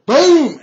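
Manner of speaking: big, high, loud blast noise; explosive boom. Acted. Game master voicing the fireball hitting a thug, explosive boom. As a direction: let the boom burst out like a blast.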